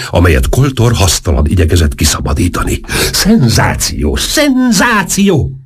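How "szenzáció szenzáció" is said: drawn-out, high-pitched exclamation; ordítás. A narrator's reading. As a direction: cry it out slowly, climbing much higher. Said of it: "ordította". (elnyújtott, magas hangú ordítás)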